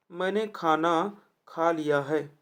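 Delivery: मैंने खाना खा लिया है spoken slowly with weight on मैंने; slow correct reading. Shown as a demonstration. Teacher models the corrected sentence slowly for students. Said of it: "वही तो सही है".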